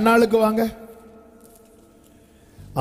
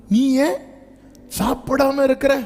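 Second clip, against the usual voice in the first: mocking husband; 7 semitones higher than usual. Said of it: "சொல்ற மாதிரி சொல்றாரு".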